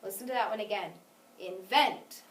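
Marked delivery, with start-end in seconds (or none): 1.4-2.2 short In, emphatic stretched vent in Invent; stress on second syllable. Shown as a demonstration. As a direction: repeat Invent clearly, punching the vent. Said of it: "The second syllable was louder".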